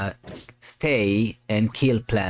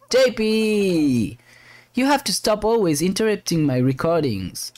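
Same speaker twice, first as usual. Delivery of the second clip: exasperated scolding; 9 semitones higher than usual. Acted. Captain to interrupting chief officer, exasperated scolding.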